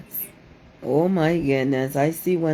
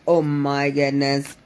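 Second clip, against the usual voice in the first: ordinary delivery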